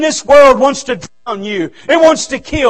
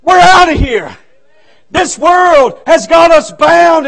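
loud, high revival-preacher shout; full-force preaching; 6 semitones higher, 6 dB louder than usual. Real, not acted. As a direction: preach it out with all your might.

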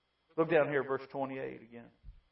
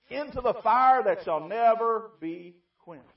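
preaching emphasis; 9 semitones higher, 12 dB louder, slower than usual. Acted. Scripture climax read loud.

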